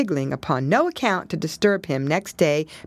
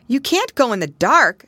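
a touch higher, urgent; lightly voiced young pig; 7 semitones higher, 5 dB louder than usual. Pigling stops Pigwig leaving. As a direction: warn her. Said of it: "said Pigling Bland".

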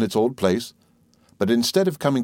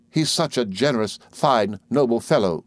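usual speaking voice

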